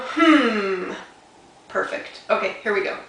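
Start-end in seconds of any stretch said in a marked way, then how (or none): 0.0-0.9 loud, drawn-out thinking hum. Shown as a demonstration. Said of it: "Put it on your chin and go".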